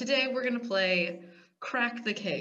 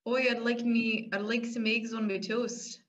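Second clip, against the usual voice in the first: botched Irish drifting to New Zealand; Irish attempt sounding Kiwi; 2 semitones higher than usual. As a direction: try Irish, come out Kiwi. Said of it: "That's Kiwi".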